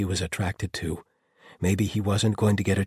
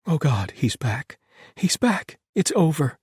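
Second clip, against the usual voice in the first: high, loud, breathless-sounding outburst; overwrought relief; 10 semitones higher, 6 dB louder than usual. A narrator's reading. Piper dangling over certain death, crying out in relief. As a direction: cry it out as if rescue has come.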